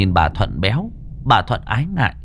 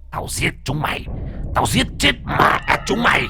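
possessed fury; 10 semitones higher than usual. Acted. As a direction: snarl then scream like a vengeful spirit. Possessed Lan grabs Hùng's throat. (vong nhập gầm gừ rồi gào thét)

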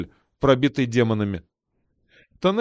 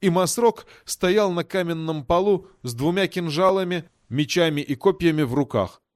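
plain reading voice

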